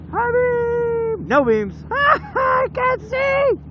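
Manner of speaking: very high squeaky laughing voice. Pretend. Squeaky high mock panic.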